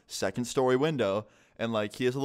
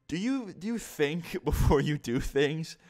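higher, slow, half-laughing disbelief; incredulous dad; 7 semitones higher, slower than usual. Retold or imitated. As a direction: play the stunned dad, drawn out with a laugh breaking in. Dad scolding, retold.

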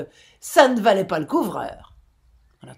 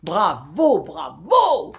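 louder, a cheer of approval; exclaiming bravo; 7 dB louder, much slower than usual. A narrator's reading. Old sea dogs cheer the captain's tale, loud bravo. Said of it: "s'écrièrent".

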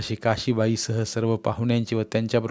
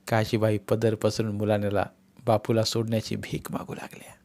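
ordinary delivery, nothing marked

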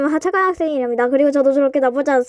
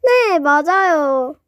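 slow drawl; 3 dB louder, much slower than usual. Acted. Drawled repeated answer.

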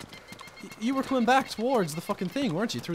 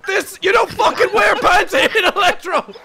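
furious yelling, much higher and louder; angry shout; 9 semitones higher, 7 dB louder than usual. Acted. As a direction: bark at the pantless intruder to get out. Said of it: "you got really angry".

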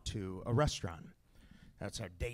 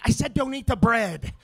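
much higher and louder yell; exasperated yell; 10 semitones higher, 11 dB louder than usual. Acted. Dad yelling at crying kid about bread, exasperated. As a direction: snap at the table, fed up. Dad losing patience at table.

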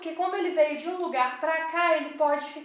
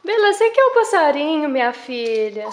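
pet talk; 12 dB louder than usual. Real, not acted. Falando com a cachorra.

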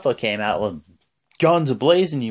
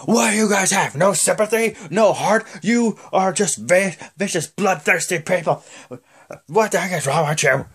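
hammed-up outraged scolding; scolding Stephanie impression; 7 semitones higher than usual. Stephanie berating the fans, mock indignant. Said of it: "then she's like".

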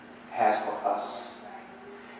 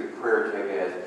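ordinary talk, nothing marked

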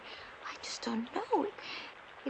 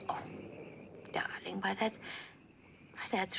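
whispering; 7 semitones lower, 5 dB quieter than usual. Acted. Soothing kitten in danger.

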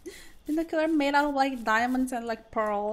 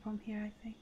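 whispering; 13 dB quieter, much faster than usual. Real, not acted. Sneaking, whispering to self.

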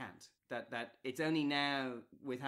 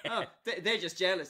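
higher, louder mocking quote; mock dismissive; 4 semitones higher, 9 dB louder than usual. Host parodies a simplistic explanation of objectors, mock dismissive. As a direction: act out a glib dismissal, loudly.

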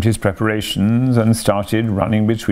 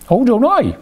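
much higher, louder scoffing washerwoman; indignant washerwoman; 13 semitones higher, 3 dB louder than usual. Acted. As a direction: toss it back like an offended washerwoman. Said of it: "said I".

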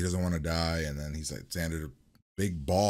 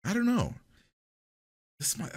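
ordinary talk, nothing marked

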